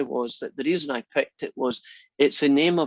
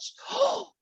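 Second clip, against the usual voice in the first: high exaggerated gasp; mock shocked gasp. Sister acting shocked at the fake swear, hammed-up gasp. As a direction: gasp theatrically. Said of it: "went over the top".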